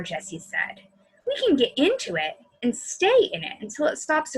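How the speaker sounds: bedtime-story narration; story reading. A narrator's reading. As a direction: read the storybook to the kids. Reads a story aloud to children.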